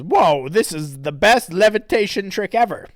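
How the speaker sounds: lightly voiced awestruck critter; cartoon creature voice. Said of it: "Wezachu says".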